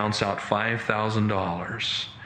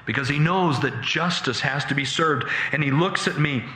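ordinary talk

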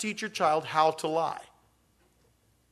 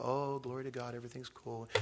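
church face; 4 semitones lower, 12 dB quieter than usual. Retold or imitated. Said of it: "we put on the church face".

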